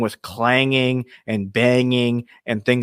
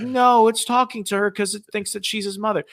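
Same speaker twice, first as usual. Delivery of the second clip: high-pitched mock protest; whiny-high put-on objection; imitated indignant believers; 10 semitones higher than usual. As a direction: imitate offended believers insisting. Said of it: "They're like".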